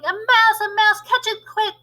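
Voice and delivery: high panicked shout. Acted. Kitchen staff chasing the mouse, loud high shout. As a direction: yell to catch the mouse. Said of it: "they were shouting".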